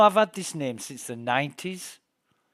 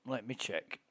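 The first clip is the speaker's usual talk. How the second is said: much softer, muttering to himself; muttered under breath; 3 semitones lower, 11 dB quieter than usual. Real, not acted. Checking the game, muttering to himself quietly. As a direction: drop the voice way down. Repeating phrase, getting quieter.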